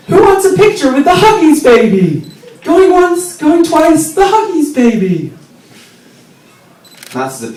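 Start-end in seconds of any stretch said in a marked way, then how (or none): 0.1-5.3 high, loud carnival barker call; mother yelling. Retold or imitated. Mother shouting to the crowd, high and loud barker call. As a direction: auction off the baby like a fairground barker. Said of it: "she would hold me up and yell".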